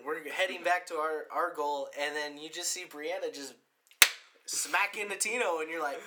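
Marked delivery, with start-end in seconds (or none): none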